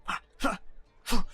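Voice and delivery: usual speaking voice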